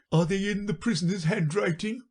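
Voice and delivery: very high, unforced question; high-pitched juror voice; juryman. Acted. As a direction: ask as a little creature on the jury. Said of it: "asked another of the jurymen".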